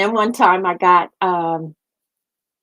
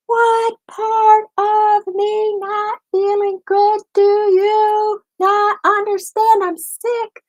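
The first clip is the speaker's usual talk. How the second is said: much higher, very slow, every word dragged out; threw a fit; 14 semitones higher, slower than usual. Retold or imitated. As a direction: spell it out slowly like you've had enough. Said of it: "So I threw a fit".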